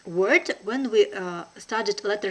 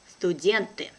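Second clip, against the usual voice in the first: brisk, natural native speed; quick native pace. Shown as a demonstration. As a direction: say it fast like a native. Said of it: "second time will be quickly, like a native".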